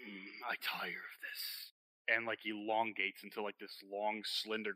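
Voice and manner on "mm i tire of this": slow, softer, weary creature voice; bored monster. Acted. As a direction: let the disguise drop with a slow sigh. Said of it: "Drake is like".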